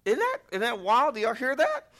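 high, loud disbelieving exclaim; incredulous outcry. Real, not acted. Pastor crying out to the church, high and loud in disbelief. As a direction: cry out in disbelief to the crowd.

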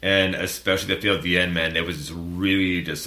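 ordinary talk

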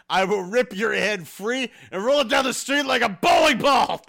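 put-on gruff old man threat, building to a shout; Macho Man voice. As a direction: growl it like a wrestling promo, then yell. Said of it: "as fucking Space Ghost's dad".

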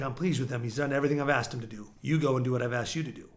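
low, soft, even voice of God; understated divine reply, hushed and deep; the Lord speaking. Acted. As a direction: drop low and quiet as God answering. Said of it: "The Lord was like".

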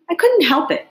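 put-on childish sulky protest. A narrator's reading. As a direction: complain like a scolded gardener. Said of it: "said Five in a sulky tone".

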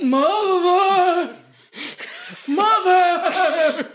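hammed-up anguished cry; mock cry out. Son calling to his dying mother, hammed-up cry. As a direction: wail it, over the top.

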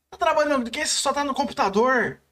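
imitação de mãe cobrando, voz mais aguda; mãe incrédula imitada. Retold or imitated. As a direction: imite uma mãe que não acredita, mais agudo. Imita mãe duvidando do trabalho. (mãe desconfiada, em tom agudo de bronca)

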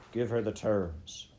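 plain reading voice

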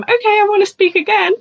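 high, quick burst of keenness; retold eager request. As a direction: pipe up fast, acting keen. Asks mentor for another go.

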